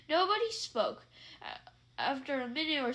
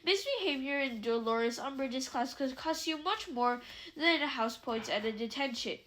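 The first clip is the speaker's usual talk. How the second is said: usual speaking voice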